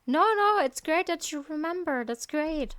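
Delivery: high, loud "no, no" easing down; emphatic reassurance. Real, not acted. Player reassuring an apologetic granny in a game, emphatic reassurance. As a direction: jump in high to wave away the apology.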